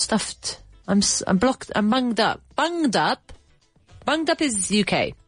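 usual speaking voice

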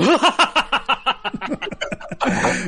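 a loud cartoon villain laugh; evil villain cackle. Asked to redo evil laugh.

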